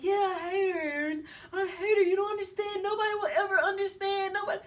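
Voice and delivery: high, whiny mock crying; mock tearful rant. Imitating a man ranting to Aaron about his ex, mock tearful. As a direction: imitate a drunk who feels nobody understands.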